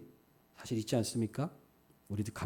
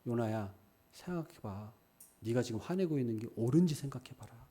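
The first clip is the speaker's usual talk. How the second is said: gentle coaxing; 5 dB quieter than usual. Pretend. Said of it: "감정을 억누르시는 게 아니라".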